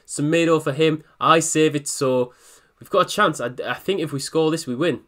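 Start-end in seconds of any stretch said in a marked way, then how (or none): none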